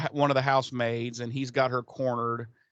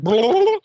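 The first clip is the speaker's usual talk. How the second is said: high, loud comic blurt of shock; mock startled yelp; 11 semitones higher, 10 dB louder than usual. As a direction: blurt it out like a jolted vampire. Vampire startled awake in dream.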